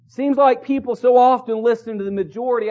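ordinary talk

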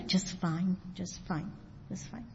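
very soft, low, fading mumble; hiding hurt. Acted. Hurt person answering how they are, hiding it quietly. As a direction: trail away to almost nothing. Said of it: "and I'm hiding it".

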